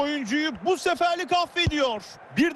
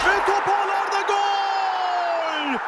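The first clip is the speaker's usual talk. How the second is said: screaming football commentator; 3 semitones higher, much slower than usual. Acted. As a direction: stretch the goal call out and yell it. Goal call in match commentary.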